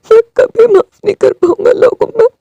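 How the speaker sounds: weep out the refusal to forgive; crying. Acted. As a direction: voice breaking in tears. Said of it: "वो रोने लगा था".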